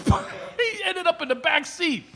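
high, broken up by laughs; words squeezed out between real laughs; laughing while telling. Real, not acted. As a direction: struggle through the story, laughing. Still laughing at own story.

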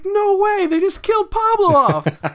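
very high-pitched disbelief; caught off guard. Retold or imitated. Fan retelling rewatching the death scene, shocked high squeal. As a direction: reenact a stunned outcry, pitch way up. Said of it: "I was caught off guard".

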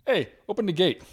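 high, loud demand; mock yell across a pool; imitated yell. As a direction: call out sharply, acting out the woman. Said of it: "yells at this Chinese lady".

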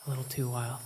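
ordinary talk, nothing marked

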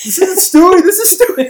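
high-pitched cartoon impression; Stewie. Arguing over whose Stewie is right, high-pitched impression. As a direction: push the voice way up, mimicking a cartoon. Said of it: "he doesn't talk like that".

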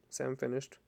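usual speaking voice

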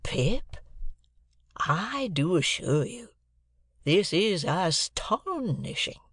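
dazed astonishment. A narrator's reading. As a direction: labour it out, still stunned. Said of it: "his senses were never coming back".

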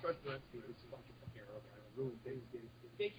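very faint, barely audible voice; drifting away from the mic; quiet. Real, not acted. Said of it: "you sound a little quiet".

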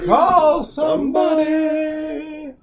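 slow drawn-out shouted catchphrase; mock shout. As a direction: belt it out like a ring announcer. Quoting the wrestler's catchphrase.

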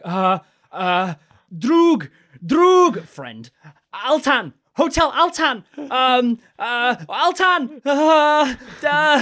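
high, loud, desperate stammering; panicked stammer. Retold or imitated. Drunk tourist pleading with a hatchet-wielding stranger, high panicked stammer. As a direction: blurt out broken words in panic.

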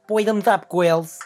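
a quick, hammed-up New York accent try. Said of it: "a New Yorkan accent? Manhattan".

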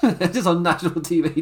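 ordinary talk

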